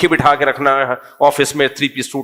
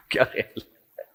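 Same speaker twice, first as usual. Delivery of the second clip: whispered aside; 11 dB quieter, slower than usual. Real, not acted. Whispered aside after laugh.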